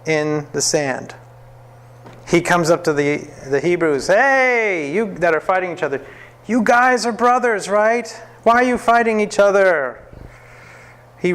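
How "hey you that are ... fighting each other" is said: Moses scolding. Acted. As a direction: call out to the brothers to stop fighting. Moses confronting the fighting Hebrews.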